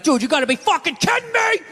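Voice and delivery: high-pitched outraged sports-fan yell; screaming at the ref. Retold or imitated. Psycho owner yelling at the ref on TV, high outraged shout. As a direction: holler it high at the television. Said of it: "you're screaming at the ref on T V".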